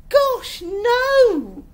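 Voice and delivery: high, loud horrified outcry; appalled, pitched right up. A narrator's reading. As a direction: recoil loudly at the very idea. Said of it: "Esmeralda looked horrified".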